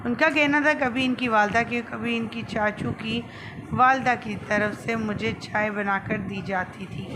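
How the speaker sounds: usual speaking voice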